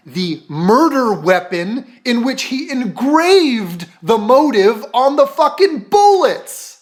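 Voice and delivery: prosecutor building to a high-pitched, incredulous finish; slow, emphatic build to exasperation; incredulous outburst. Acted. Mock prosecutor presenting the murder weapon to the judge, incredulous.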